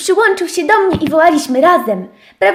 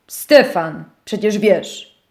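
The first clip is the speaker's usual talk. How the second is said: lekko niższe mruknięcie; 5 semitones lower, slower than usual. Acted. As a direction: mruknij niżej. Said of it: "mruknął miś". (miś mruczy do owieczki, niżej)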